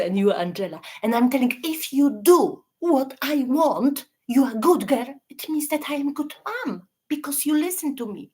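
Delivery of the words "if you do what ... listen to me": hint of a bossy, guilt-tripping mother; role-playing a controlling mom. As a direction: play the mom who only loves when obeyed. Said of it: "I, I'm your mom, I'm the abuser".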